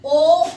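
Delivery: ओ said loud and long, vowel held open; very clear ओ. Shown as a demonstration. Teacher to beginners, saying ओ very clearly and loudly. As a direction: call out ओ so every child hears it. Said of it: "very clear".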